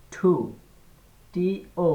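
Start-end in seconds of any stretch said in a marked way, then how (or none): 0.1-0.3 "টু" said as "ঠু", with a puff; "টু" opened on a breathy ঠ instead of ট; aspirated ঠ. Shown as a demonstration. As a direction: model "টু" with aspirated T. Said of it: "ঠ দিয়ে উচ্চারণ হবে".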